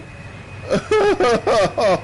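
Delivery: high-pitched real giggling fit; helpless high laughter; high giggle. Real, not acted. Gamer cracking up at own mistake, giggling high.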